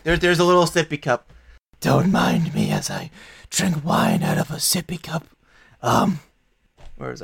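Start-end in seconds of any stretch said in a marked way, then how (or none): 1.8-5.3 a silky, bargaining fiend, lightly voiced; a chained devil bargaining with the party, silky; smooth devil. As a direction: sell the deal like a charming devil.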